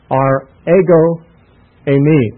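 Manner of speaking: "ego eimi" pronounced eh-go ay-mee, slow and deliberate; slow careful Greek. Shown as a demonstration. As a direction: spell out "ego eimi" slowly like a teacher. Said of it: "Ego is like ego, E G O".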